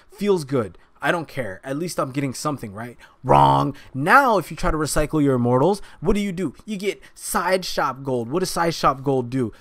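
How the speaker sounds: usual speaking voice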